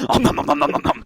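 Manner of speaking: cartoon gobbling noises, om nom nom; muffled, voiceless chomping sounds; mimicked greedy munching. Mocking a woman with her head buried in the plate, greedy munching.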